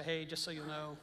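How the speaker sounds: understated, matter-of-fact re-enactment; low-key telling. Retold or imitated. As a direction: pass the news along casually and quietly. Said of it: "kinda telling it kinda like I'm saying it".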